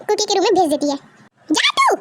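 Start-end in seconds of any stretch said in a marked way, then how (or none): 1.5-2.0 a feisty grandmother nagging her son; bossy grandma Mrs Jeon. Acted. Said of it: "गुस्से से वी को".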